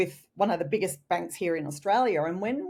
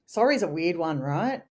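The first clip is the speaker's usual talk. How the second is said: ordinary delivery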